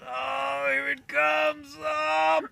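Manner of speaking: high, slow, loud labour cry; hammed-up shout while pushing out an egg; straining to push. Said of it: "And Dick pushes, and he's".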